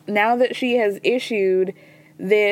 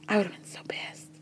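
voiceless whisper, much softer; emphatic whisper; 2 semitones lower, 13 dB quieter, faster than usual. Real, not acted. Podcaster imagining herself in his place, whispered. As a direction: drop to a whisper for effect. Stressing her own reaction for effect.

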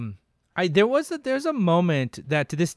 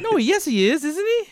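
flustered doubt; 13 semitones higher than usual. Real, not acted.

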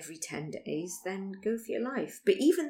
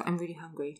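chewing while talking; words round a bite of olive; mouth full. Real, not acted. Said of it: "I'm eating an olive".

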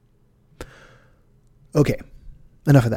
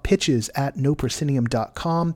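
ordinary talk

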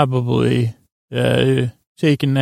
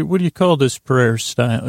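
usual speaking voice

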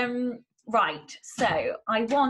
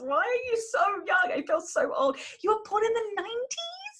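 shrill, playful disbelief; high exasperated squeal; 13 semitones higher than usual. Real, not acted. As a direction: squeal in mock horror. Mock despair about being old.

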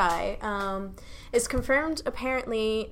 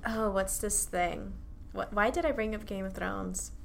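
trailing off; 2 semitones lower, 10 dB quieter than usual. Real, not acted. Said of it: "I'm going crazy".

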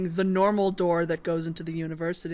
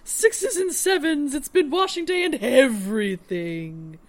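posh lady voice; 6 semitones higher, 5 dB louder, slower than usual. Acted. Housekeeper Whitlow voiced.

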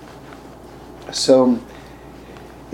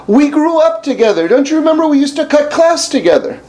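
quoted friend; 13 semitones higher, 4 dB louder than usual. Retold or imitated. Retold quote of old friend.

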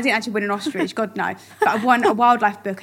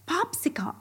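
American accent; 6 semitones higher, 9 dB quieter than usual. Shown as a demonstration. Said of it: "Do you put an ice lolly or popsicle?".

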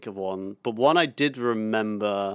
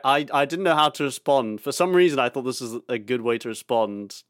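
ordinary talk, nothing marked

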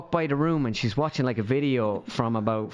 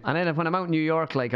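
normal talking voice

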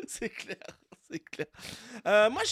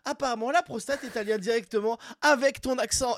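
raised, punchy headline read; high-pitched radio bulletin delivery; odd-news announcer; 6 semitones higher, 3 dB louder than usual. Acted. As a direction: present it like a bizarre news flash. Reads out an odd news item.

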